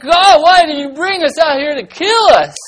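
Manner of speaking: high, loud, hammed-up protest; grumbling at God. Thirsty Israelites complain to God in the story, loud theatrical wail. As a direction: cry out in mock outrage. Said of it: "They're saying".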